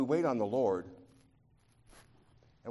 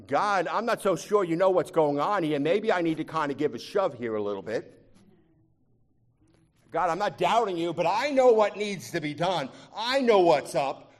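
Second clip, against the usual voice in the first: louder, pushy demands aimed at God; mock impatient prayer; 6 semitones higher, 8 dB louder than usual. Preacher mocking an impatient believer's prayer, pushy and loud. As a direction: nudge God like he's running late.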